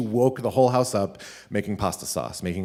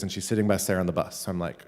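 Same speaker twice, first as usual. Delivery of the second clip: plain reading voice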